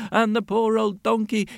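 ordinary delivery

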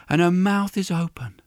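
ordinary delivery

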